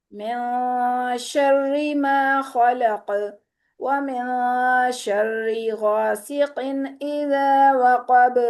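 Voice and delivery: slow, melodic tajweed recitation; Quran recitation. Acted. Teacher reciting Surah Falaq for qalqalah practice. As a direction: recite with drawn-out, measured melody.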